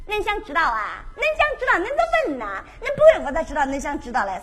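Henan-dialect nagging monk, fast at end; Tang Seng pestering in Henan dialect. Acted.

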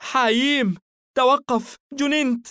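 high, loud, urgent mother's cry; alarmed yell in short bursts; screaming at child. A narrator's reading. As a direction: shout to halt a child at the door. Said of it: "صرخت بطفلها".

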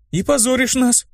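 high, mildly shouted reproach; indignant cry. A narrator's reading. Blonde reproaching her friend, high indignant cry. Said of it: "крикнула блондинка".

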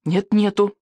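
put-on stubborn childish retort; boy insisting back. A narrator's reading. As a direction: pipe the denial straight back. Boy contradicts king in quarrel.

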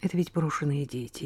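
softly weighing each word; soft and grave. Acted. Restated softly.

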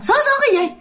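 high-pitched, rapid-fire scolding; acted indignant telling-off; angry scolding. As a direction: berate him as an irate spirit. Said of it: "tán mặt anh bạn nói rằng".

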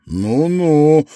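smug, loud "well well"; gloating man. A narrator's reading. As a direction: gloat over the caught woman. Hunter gloats over her. (злорадно)

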